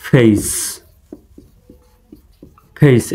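ফেস with a gliding ei, like face; ফেস pronounced feis, drawing out the ei; correct pronunciation. Shown as a demonstration. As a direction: model it slowly: ফেস as fei-s. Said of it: "এভাবে উচ্চারণ করা সঠিক".

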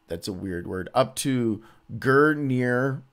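ordinary talk, nothing marked

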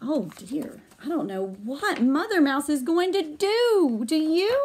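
plain reading voice

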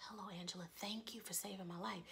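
hushed, secretive whisper; barely audible whispered greeting; quiet whisper. Shown as a demonstration. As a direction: whisper it as if nobody should hear. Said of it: "He didn't say it quietly".

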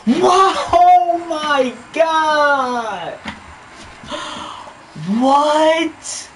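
2 high, stretched shout of disbelief; 3 drag out the shock; 4 stunned gamer's shout; 1 real shocked shout. Real, not acted. Stunned reaction to game play.

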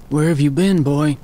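put-on hoarse croaking old voice. A narrator's reading. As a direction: croak it like an unused voice. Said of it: "his voice croaked".

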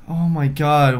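normal talking voice

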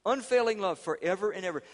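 loud, high preacher's proclamation; proclaiming. Shown as a demonstration. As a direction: ring it out to the congregation. Proclaims unfailing love.

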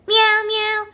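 high, loud imitation cat meow; squeaky-pitched pretend kitty. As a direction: mimic a cat meowing on cue. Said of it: "say meow again".

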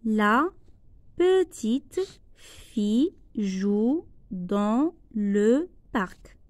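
slow, careful repeat of the sentence; spaced dictation. Shown as a demonstration. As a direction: say it again slowly for the class. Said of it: "Je répète".

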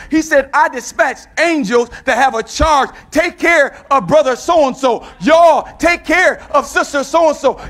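preacher roaring the promise at the church; full-force preaching. Acted. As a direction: build to a ringing shout on no weapon.

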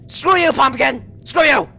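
high, sharp startled yell; startled angry shout. Real, not acted. Player cursing a pumpkin after a scare, angry shout. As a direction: yell it at the thing that scared you.

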